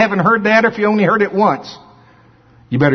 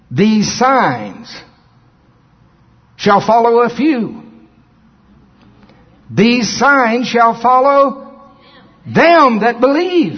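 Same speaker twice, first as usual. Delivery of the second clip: slow, drawn-out scripture read; preaching scripture; 5 semitones higher, much slower than usual. Acted. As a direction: preach each word slowly. Slow emphatic scripture reading.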